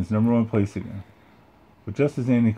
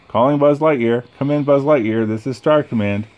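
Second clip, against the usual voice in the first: higher, louder commanding call; toy radio voice; 5 semitones higher, 6 dB louder than usual. Acted. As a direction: announce like a mission base. Toy voice calls Buzz.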